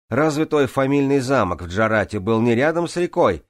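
high, loud challenging question; shout. A narrator's reading. Swimmer questions Джанель about her upbringing, shouting. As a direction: shout it across the water. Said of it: "крикнул я".

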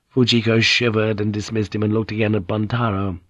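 ordinary delivery

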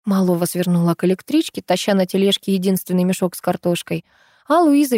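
plain reading voice

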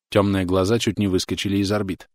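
usual speaking voice

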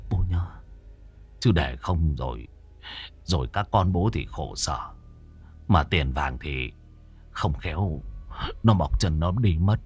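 low, soft murmured plea to a dead father; hushed fearful prayer. Acted. Son praying to his dead father, hushed and fearful. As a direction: whisper a nervous prayer at the altar.

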